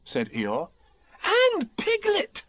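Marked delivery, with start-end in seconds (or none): 1.2-2.4 put-on very high, loud boyish glee; thrilled little boy calling out; excited. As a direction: cry it out slowly in delight. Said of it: "said Christopher Robin excitedly".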